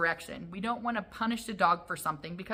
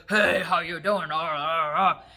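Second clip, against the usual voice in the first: loud, booming mock big-man greeting; big guy's voice; 5 dB louder than usual. Bigger guy walks into your house, loud put-on greeting. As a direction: put on a hefty man's hearty holler. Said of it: "bigger guy walk into your house".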